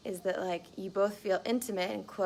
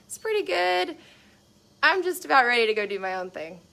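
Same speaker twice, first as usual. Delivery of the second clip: contented partner; 7 semitones higher, 6 dB louder than usual. Retold or imitated. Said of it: "So five being like".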